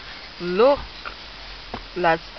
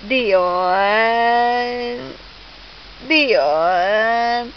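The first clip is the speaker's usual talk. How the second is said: lower, very drawn-out name; teasing build-up; 4 semitones lower than usual. Pretend. Mother teasing baby before the boo, long drawn-out call. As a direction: stretch the name to build suspense.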